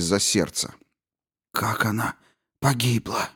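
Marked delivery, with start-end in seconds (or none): 1.5-3.3 soft, halting shocked question; struggling to speak. A narrator's reading. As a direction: force the words out. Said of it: "с трудом выговаривая слова".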